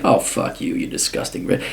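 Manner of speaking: whispered insult under the breath; muttered curse. Retold or imitated. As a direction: hiss it out under your breath. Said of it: "It's like".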